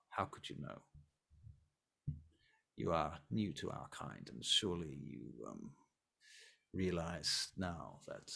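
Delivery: cold vampire prince. Acted. Prince continues cold rebuke.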